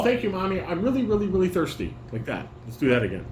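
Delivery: ordinary talk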